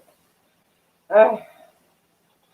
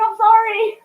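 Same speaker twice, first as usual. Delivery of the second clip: squeaky apology; 5 dB louder than usual. Retold or imitated. Sister-in-law's retold apology.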